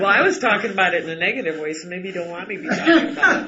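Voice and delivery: laughing defence of his negative remark. Real, not acted. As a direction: laugh it off while explaining. Laughs while defending himself.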